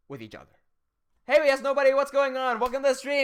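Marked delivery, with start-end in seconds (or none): 1.3-3.3 loud, higher streamer welcome; hyped greeting. Real, not acted. Streamer welcoming viewers back, loud and upbeat.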